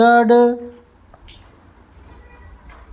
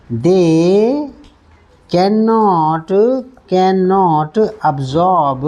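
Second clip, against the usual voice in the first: cannot absorb dragged very slowly; very slow drill; much slower than usual. Shown as a demonstration. Teacher drilling the phrase very slowly. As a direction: spell it out word by word. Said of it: "repeated slowly".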